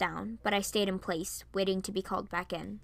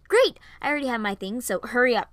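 excited; 3 semitones higher, 8 dB louder, faster than usual. Acted. Varian eager to leave together.

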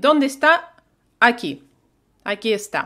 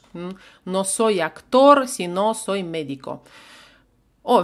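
normal talking voice

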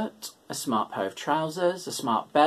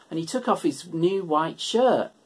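ordinary delivery

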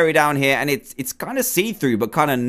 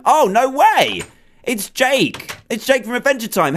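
surprised; 8 semitones higher than usual. Real, not acted. Surprise seeing Jake appear.

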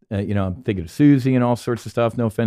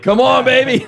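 much louder and higher, pleading laugh; urging the jet; 13 semitones higher, 10 dB louder than usual. Retold or imitated. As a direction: beg the jet to pull up, half laughing.

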